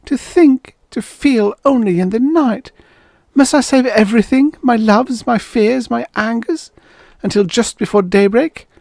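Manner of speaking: high, loud despairing cry; wife's anguished protest; anguished outburst. Acted. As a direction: pour out her despair.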